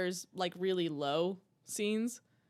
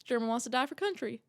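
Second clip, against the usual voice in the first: deadpan Southern threat; Southern accent impression; 2 semitones higher than usual. Fan quoting Brad Pitt's order, deadpan Southern accent. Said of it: "He goes".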